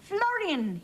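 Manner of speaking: high call of the name; shout. A narrator's reading. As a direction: call the horse urgently. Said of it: "he shouted".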